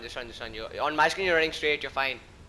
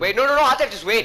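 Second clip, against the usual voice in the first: urgent shout; 6 semitones higher, 8 dB louder than usual. Real, not acted. Stopping teammate mid-fight.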